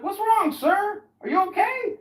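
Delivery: very high, loud worried question; high concerned voice; concerned passer-by. Retold or imitated. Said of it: "I was like".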